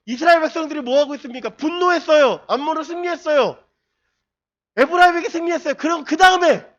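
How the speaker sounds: high, loud impassioned sermon; preaching at full force. Acted. Pastor exhorting the congregation, impassioned and loud. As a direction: drive it home like a fiery preacher.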